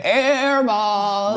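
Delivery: high taunting call of air ball; mocking crowd call. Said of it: "They say".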